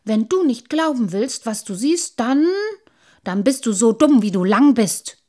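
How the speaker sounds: loud, indignant retort; little sprite telling him off; scolding. A narrator's reading. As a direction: build to a defiant insult. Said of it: "schimpfte der kleine Wassermann".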